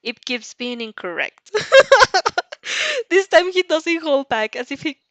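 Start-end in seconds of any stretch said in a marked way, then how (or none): 1.6-3.1 loud, high hearty burst of laughter; big amused laugh. Acted. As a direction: throw the head back and laugh. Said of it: "he simply laughs heartily".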